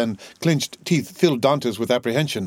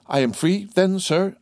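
higher-pitched, mildly joyful question; a touch of joyful relief; joyful cry; 8 semitones higher than usual. A narrator's reading. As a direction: ask with hopeful joy, pitched up. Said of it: "cried Dantès joyfully".

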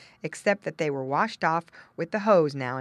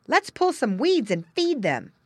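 put-on higher, louder boyish suggestion; eager boy; 8 semitones higher, 6 dB louder than usual. Boy to girls at the pen, eager. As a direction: propose it like a keen child. Boy proposes feeding pigs.

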